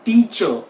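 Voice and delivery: टीचर as tee-chuh, silent r; correct pronunciation. Shown as a demonstration. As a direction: drop the final r in टीचर. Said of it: "that is silent in this". (टीचर का सही उच्चारण, बिना र के)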